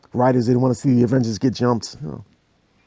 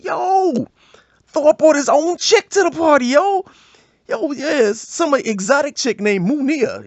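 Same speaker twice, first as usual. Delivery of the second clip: hyped instigator; 13 semitones higher, slower than usual. Acted. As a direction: shout the rumor to the crowd. Hype man announcing.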